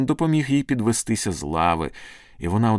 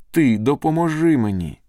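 higher, louder cry for help; anguished plea; 7 semitones higher, 5 dB louder than usual. A narrator's reading. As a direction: cry out an inner prayer without real sobs. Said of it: "заридала її душа".

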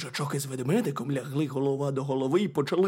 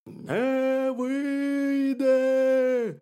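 high, drawn-out hare from underground; hollow, high wailing hare; muffled burrow voice; 13 semitones higher, 6 dB louder, much slower than usual. A narrator's reading. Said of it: "почулося з нори".

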